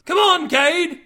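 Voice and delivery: high, loud yell. A narrator's reading. Crewmate calls Cade back aboard, yelling. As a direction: holler to someone across the water. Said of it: "one of them yelled".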